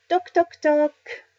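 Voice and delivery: loud, high-pitched knock-knock-knock; voiced knocking. A narrator's reading. Pépita knocks at the caravan door, voiced loudly. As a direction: rap out the knocks with the voice.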